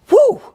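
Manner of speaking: sharp high, loud "whew" of exasperation; high exasperated whew. Retold or imitated. Pastor mocking himself over the woodpecker, exasperated whew. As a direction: blow out a shrill, exasperated whew.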